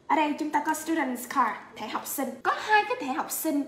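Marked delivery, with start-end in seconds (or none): none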